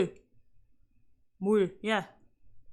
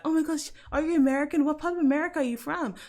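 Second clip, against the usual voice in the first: higher, rushed gushing questions; excited commenter; 8 semitones higher, faster than usual. Retold or imitated. Viewer asking if the speaker is American, high rapid mock excitement. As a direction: quote a nosy commenter, high and fast. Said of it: "I get comments that are like".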